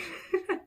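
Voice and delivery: high, short giggle; amused laugh. Real, not acted. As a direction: giggle after the impression. Amused by her imitation.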